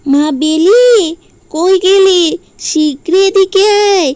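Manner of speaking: loud, high call to her daughter; calling out excitedly. Acted. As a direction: call her over, thrilled.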